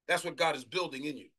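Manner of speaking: ordinary delivery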